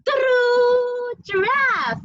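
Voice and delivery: high, drawn-out fanfare; slow, high ta-da for toddlers; playful reveal. Real, not acted. Parent revealing a toy giraffe to kids, playful high fanfare.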